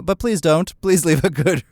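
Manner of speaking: ordinary delivery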